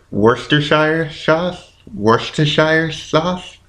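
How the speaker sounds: "Worcestershire" slow and questioning, ending like "shire"; unsure pronunciation. Real, not acted. Podcaster guessing at a hard sauce name, unsure pronunciation. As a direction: sound out "Worcestershire" uncertainly, rising to a question. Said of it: "I don't know how do you".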